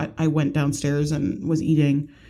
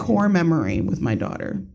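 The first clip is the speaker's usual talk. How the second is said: normal talking voice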